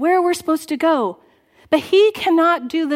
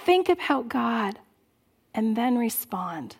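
usual speaking voice

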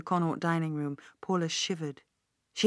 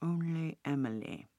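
"Only Emily" drawn out, softer and pensive; said slowly; 8 dB quieter than usual. A narrator's reading. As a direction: let "Only Emily" hang, slow and quiet. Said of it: "he said slowly".

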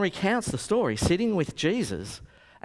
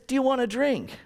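higher-pitched, rough attempt at an English accent; 8 semitones higher than usual. Rock star offering fan a drink, imitated English accent. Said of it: "a bit of an English accent".

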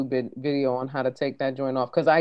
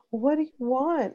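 higher-pitched mom voice; mom asking child; 8 semitones higher, 4 dB quieter than usual. Retold or imitated. Host retells mom asking in TikTok, higher mom voice. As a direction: imitate a mom asking her little girl. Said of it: "her mom's like".

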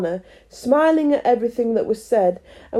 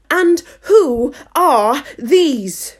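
loud, high, very slow demand; imperious queen; 5 semitones higher, 6 dB louder, much slower than usual. Acted. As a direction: pronounce each word as a stern queen. Severe Queen demands an answer.

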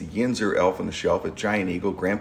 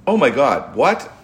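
higher, louder shocked cry; shocked mom; 5 semitones higher, 5 dB louder, slower than usual. Retold or imitated. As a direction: imitate her alarm. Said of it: "Lou is like".

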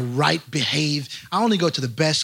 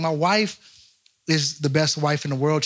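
ordinary talk, nothing marked

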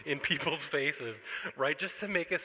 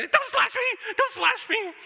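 high, loud, hammed-up panicked shriek; mock panic; 12 dB louder, slower than usual. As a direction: squeal at the kids like splashing is a disaster. Parent panicking at kids splashing.

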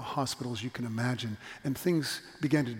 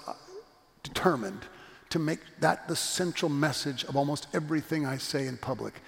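usual speaking voice

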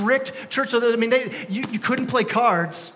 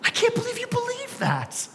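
high, mocking disbelief, much higher pitch; incredulous grandkids; 14 semitones higher, 6 dB quieter, slower than usual. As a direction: play the stunned grandkid who can't believe it. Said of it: "your grandkids are gonna look at you and go".

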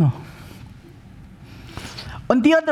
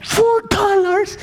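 much higher, slow astonished cry; amazed; much slower than usual. Retold or imitated. Swiss guest amazed at the cheap cigar, high and slow. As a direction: stretch it out in delight.

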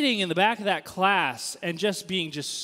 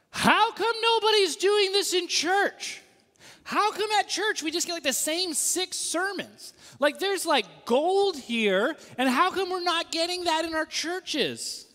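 much higher, slow, louder passionate plea; fired-up question; 10 semitones higher, 3 dB louder, slower than usual. Retold or imitated. Seminary student asking classmates why churches miss this, fired up. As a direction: burst with excitement at the discovery.

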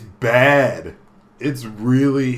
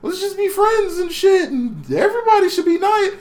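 put-on much higher, sing-song girly voice; nice girl character; 4 dB louder than usual. The kind girl urging everyone to be friends, much higher and sing-song. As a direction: play her up high and sweet. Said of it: "a character in the game who she's just kinda like".

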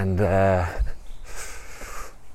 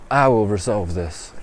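usual speaking voice

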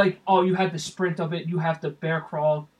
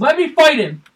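loud, high re-enacted plea; acted eagerness; 9 semitones higher, 13 dB louder than usual. Teen begging a dad to allow the fight, re-enacted loud eagerness. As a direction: beg loudly for the chance, pitched up.

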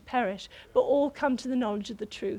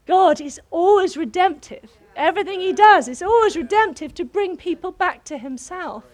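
preaching; 6 semitones higher, 8 dB louder than usual. Acted. Preaching emphasis.

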